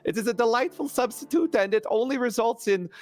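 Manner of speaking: high, swooping salesman-like character voice; zealous Nibbles, eager crank. Acted. Nibbles selling pig's blood to Lady Taryn, fussily enthusiastic. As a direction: pitch it eagerly, dropping away at the drawbacks.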